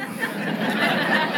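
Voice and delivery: quiet unvoiced laugh mid-quote; breathy laugh. Real, not acted. Preacher laughing while imitating his friend, soft laugh.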